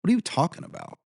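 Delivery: a builder retelling how he answered clients who accused him of stealing, in disbelief; incredulous. Retold or imitated. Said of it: "I'm like".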